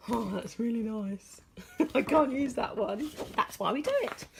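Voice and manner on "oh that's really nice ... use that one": imitating a customer. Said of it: "And one to go".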